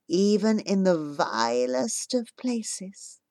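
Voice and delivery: soft and tender, a hint of a mother speaking from memory; gentle remembered voice. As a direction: speak softly, like a loving voice from the past.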